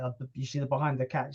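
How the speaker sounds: ordinary talk, nothing marked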